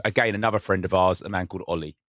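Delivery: ordinary talk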